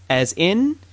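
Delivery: usual speaking voice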